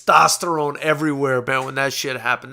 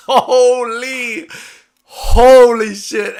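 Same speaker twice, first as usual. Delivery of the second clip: laughing, then a loud drawn-out holy shit; amazed exclamation; 7 semitones higher, 9 dB louder, much slower than usual. Real, not acted. Telling fans about the Taylor-Prograis face-off, laughing amazed shout. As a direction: blurt it out, thrilled by the memory.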